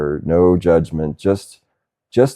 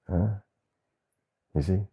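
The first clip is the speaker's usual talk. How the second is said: ordinary talk, nothing marked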